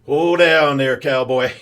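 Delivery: loud mock cowboy accent, drawn out; hammed-up cowboy accent reining in an eager greenhorn. New Yorker mentor teasing an eager recruit, loud put-on cowboy accent. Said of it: "he threw in this, uh, you know, cowboy accent".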